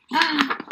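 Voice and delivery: soft staged gasp of surprise; mock gasp. Teacher reveals a new shape to the kids, soft mock gasp. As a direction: gasp as if amazed to see it.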